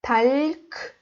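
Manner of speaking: ordinary talk, nothing marked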